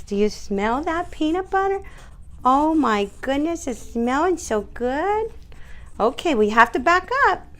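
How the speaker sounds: very high sing-song to the dog; high squeaky treat tease for the dog. Real, not acted. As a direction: tempt him with a squeaky treat voice. Dog licking peanut butter.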